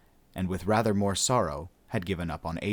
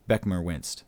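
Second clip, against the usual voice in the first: ordinary delivery, nothing marked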